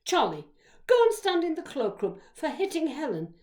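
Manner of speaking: loud, scolding bellow. A narrator's reading. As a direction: send a pupil out with a loud command. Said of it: "in her low bellow voice".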